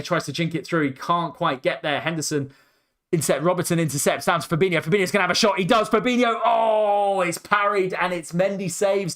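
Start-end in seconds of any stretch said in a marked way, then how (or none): 4.8-9.1 excited shot call. Real, not acted. Fan commentator calling a Fabinho shot, rising excited shout. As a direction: build up the call as he shoots.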